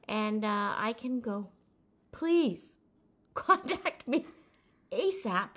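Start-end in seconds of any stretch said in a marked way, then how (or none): 2.1-5.5 pleading through a laugh; laughing plea. Pretend. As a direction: beg with a chuckle.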